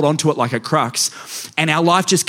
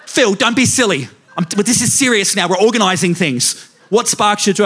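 higher-pitched, mock-exasperated wife with a laugh; imitating his wife; 6 semitones higher, 3 dB louder than usual. Wife pushing her husband to choose what sparks joy, higher, mock-exasperated. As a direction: press him again, half laughing, half impatient.

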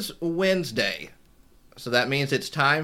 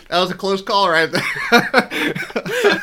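laughing; 4 semitones higher, 5 dB louder, much slower than usual. Real, not acted. Laughing after a surprise.

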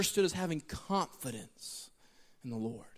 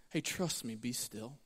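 hushed reassurance; 10 semitones lower, 10 dB quieter, slower than usual. Retold or imitated. Voice of the Lord, gentle.

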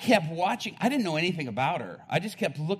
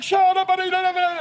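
frantic shout; 9 dB louder than usual. Retold or imitated. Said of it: "And he's like".

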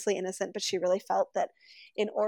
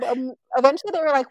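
plain reading voice

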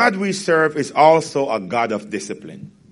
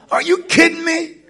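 ordinary talk, nothing marked

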